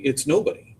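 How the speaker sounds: mildly weary reassurance. A narrator's reading. Father reassures son nobody is to blame, wearily. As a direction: answer with a touch of tiredness. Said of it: "he says wearily".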